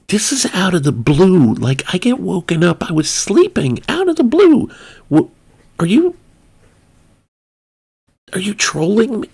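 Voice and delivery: can't believe this call is real; incredulous. Retold or imitated.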